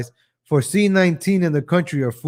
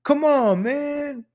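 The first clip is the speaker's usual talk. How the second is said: much higher mock old-man drawl; Joe Biden; 11 semitones higher, much slower than usual. Mocking the president's catchphrase, high and drawn out. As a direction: do a hammed-up old politician. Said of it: "It's like Joe Biden".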